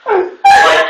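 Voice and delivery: high shouted laugh breaking out; burst of laughter. Real, not acted. Reader cracks up mid-line.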